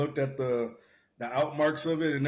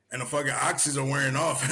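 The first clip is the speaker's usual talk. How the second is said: ordinary talk, nothing marked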